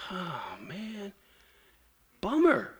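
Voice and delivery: imitating a deflated sigh of disappointment; bummed out. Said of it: "He's bummed out".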